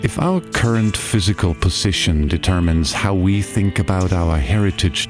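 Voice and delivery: normal talking voice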